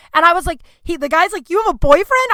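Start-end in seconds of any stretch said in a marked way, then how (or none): 1.5-2.3 high, loud disbelieving squeal; mock shock at the news; handcuffed guy learning she has a boyfriend, shocked; shocked question. Panicked guy handcuffed to bed.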